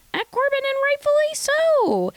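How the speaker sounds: high, loud righteous pitch; indignant outburst. Real, not acted. Host backing Kenzie against Corbin, indignant and high. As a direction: stand up for her outrage.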